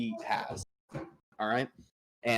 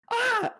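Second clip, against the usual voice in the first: surprised squeal; 4 dB louder than usual. Real, not acted. Startled reading a chat message.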